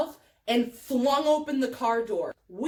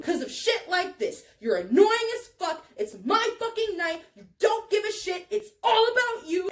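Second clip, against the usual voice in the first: higher, mounting accusations; angry rant; 6 semitones higher than usual. Retold or imitated. Teller unloading on drunk friend, higher angry rant. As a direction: let the grievances pile up hotly.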